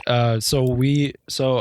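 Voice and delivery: normal talking voice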